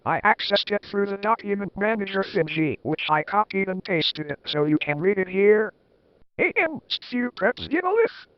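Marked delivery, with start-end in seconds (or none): none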